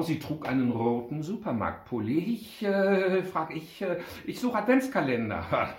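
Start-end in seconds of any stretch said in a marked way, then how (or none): 2.2-5.7 lightly voiced hesitant girl, higher pitched; a touch of flustered little girl; flustered child. A narrator's reading. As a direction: stammer it out, higher. Startled girl answers shop assistant.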